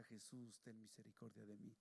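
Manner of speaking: very soft, low plea; low murmured prayer for mercy; hushed retelling. Retold or imitated. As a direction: recall the cry almost under the breath. Cry recalled in hushed reflection.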